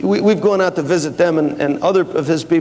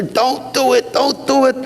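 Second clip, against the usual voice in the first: raised-pitch pleading, mildly imitated; manager's pleading; 6 semitones higher than usual. Produce manager begging the boss not to, in a raised pleading voice. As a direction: plead higher, a touch alarmed.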